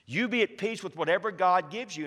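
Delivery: usual speaking voice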